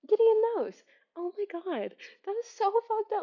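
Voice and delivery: high, stunned dawning horror; shocked realization. Real, not acted. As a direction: gasp the realization.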